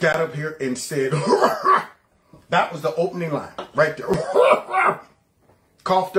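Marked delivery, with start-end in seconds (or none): 1.2-1.9 sudden high-pitched burst of laughter; real laugh. Real, not acted. Host cracking up at the speech's opening line, high laugh. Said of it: "I shouldn't be laughing". 4.1-5.0 loud, very high cackling laugh; real laugh. Real, not acted. Host losing it at that opening line, high cackle. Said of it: "I shouldn't be laughing".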